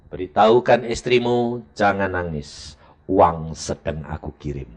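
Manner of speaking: low, slow and calm divine voice; voice of God. Retold or imitated. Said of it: "Tuhan ngomong sama saya". (suara Tuhan yang ditirukan, rendah dan pelan)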